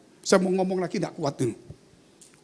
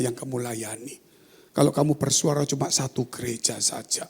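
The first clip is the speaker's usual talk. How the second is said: low, deliberate divine admonition, swelling on the key word; weighty voice from heaven; God's voice; 3 semitones lower, 3 dB louder than usual. Retold or imitated. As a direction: speak as God correcting a servant. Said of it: "Saya mendengar suara".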